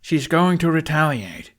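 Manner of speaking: high, loud, clipped warning; sharp, terse warning; short and sharp. A narrator's reading. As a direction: bite the words off, urgent. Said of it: "he said shortly and sharply".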